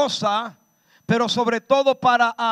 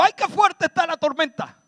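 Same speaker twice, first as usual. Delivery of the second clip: high, whiny mock panic; a mock-scared cry about the storm; mock fearful wail; 7 semitones higher than usual. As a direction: mimic a frightened disciple, hammed up. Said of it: "Jesús no dijo".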